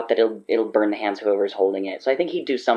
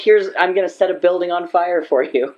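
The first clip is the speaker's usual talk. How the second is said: playful eager voice; 6 semitones higher, 5 dB louder than usual. Retold or imitated. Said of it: "I think the joke answer is, like".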